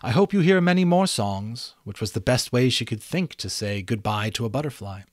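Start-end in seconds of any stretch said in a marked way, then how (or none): 0.0-1.7 high, louder unicorn voice. A narrator's reading. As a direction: kindly wish him well.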